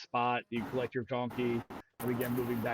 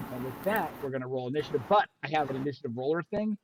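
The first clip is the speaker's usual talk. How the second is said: usual speaking voice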